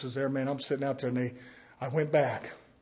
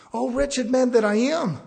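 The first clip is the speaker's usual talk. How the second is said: lamenting Paul, loud; 9 semitones higher, 6 dB louder than usual. Retold or imitated. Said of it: "He didn't say".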